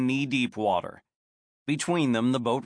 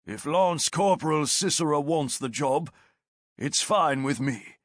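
lightly voiced veteran sergeant; a hint of a steady soldier; sergeant's voice; 3 semitones higher than usual. Martius voiced by narrator.

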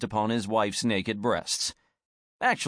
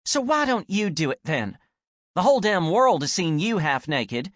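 angry retort; 6 semitones higher, 3 dB louder than usual. Acted.

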